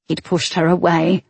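plain reading voice

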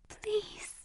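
tiny, high, very soft voice; faint frightened whisper; pleading whisper. A narrator's reading. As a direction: barely breathe the plea. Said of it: "he whispered".

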